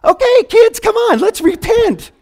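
high cartoon mouse telling the kids to repent; Mickey Mouse voice. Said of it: "I feel like Mickey Mouse".

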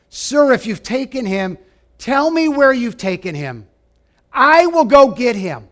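Mary pleading with the gardener, rising to a cry; desperate plea. Retold or imitated. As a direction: beg for him, rising to a cry at the end. Mary begs the supposed gardener.

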